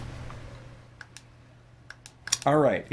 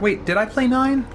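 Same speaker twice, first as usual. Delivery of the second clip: sudden high surprised question; 13 semitones higher than usual. Real, not acted. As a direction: caught off guard, voice jumps up. Suddenly doubting his own memory.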